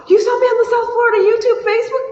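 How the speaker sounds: very high-pitched, tearful-sounding excitement; overjoyed. Real, not acted. Streamer thanking Ben for finding her, overjoyed. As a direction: squeal with happy, overwhelmed surprise. Learning where donor saw her.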